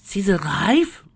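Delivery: high, loud, rising question; astonished exclamation. A narrator's reading. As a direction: exclaim it in disbelief, voice shooting up. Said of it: "Screwball exclaimed".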